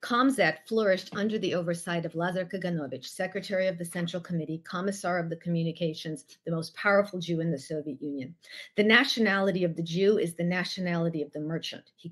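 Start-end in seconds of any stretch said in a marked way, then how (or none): none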